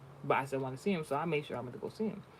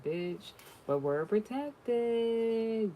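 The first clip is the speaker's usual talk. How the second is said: mock protected; 8 semitones higher, much slower than usual. Voicing client's relieved reaction.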